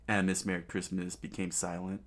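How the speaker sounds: usual speaking voice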